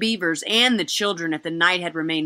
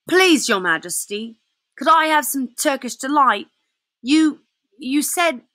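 put-on timid boy; 7 semitones higher, 3 dB louder than usual. Edmund timidly asks the witch.

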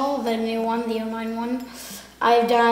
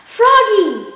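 high squeaky cartoon voice; loud, bright character yelp; 11 semitones higher, 9 dB louder than usual. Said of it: "Can you show us?".

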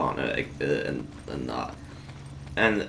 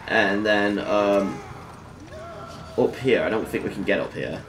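ordinary talk

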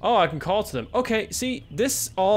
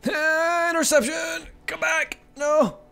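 excited panic; 13 semitones higher, 5 dB louder than usual. Real, not acted. Mother chasing her wandering kids, frantic and high. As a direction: yelp after them, pitched way up.

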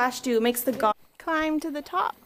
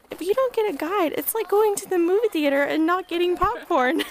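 put-on kid's voice; 7 semitones higher than usual. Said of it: "this little kid goes".

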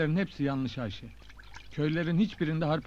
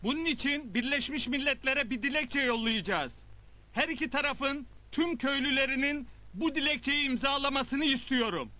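calling out to the crowd; rousing speech; 8 semitones higher than usual. Acted. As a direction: whip up the crowd. Rallies crowd, calls for agreement.

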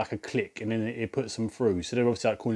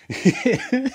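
high-pitched giggle; laugh; 13 semitones higher than usual. Real, not acted. Cracks up at own prank.

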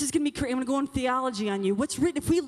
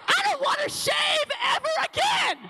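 excited declaration; 10 semitones higher than usual. Pretend. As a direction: proclaim it like a victory. Voicing a girl's wish loudly.

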